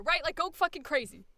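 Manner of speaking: ordinary talk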